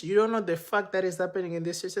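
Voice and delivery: "You don't know the fact" repeated fast at normal level; rushed repeat. Retold or imitated. Protocol guys repeating their warning, fast and clipped. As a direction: rattle the warning off quickly.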